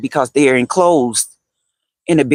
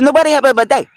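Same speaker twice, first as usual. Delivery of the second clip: very high Egyptian-accented mimicry; imitating Egyptian woman; 12 semitones higher, much faster than usual. Egyptian lady repeating her question to a baffled friend, imitated accent. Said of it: "That's how she talked".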